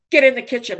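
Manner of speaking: sudden loud gruff order, then back to normal; loud command. Retold or imitated. AA old-timer ordering the grieving mother into the kitchen, loud. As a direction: bark it like a blunt old sponsor.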